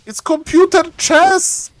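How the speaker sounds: high, slow exclamation; exclaiming. Real, not acted. Caster on the computer chess, high drawn-out exclamation. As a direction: draw it out high, in disbelief.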